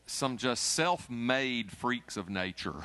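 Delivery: "self-made" drawn out slowly and leaned on; stress on self-made. Shown as a demonstration. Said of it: "I emphasize the word self-made".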